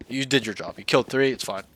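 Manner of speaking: ordinary talk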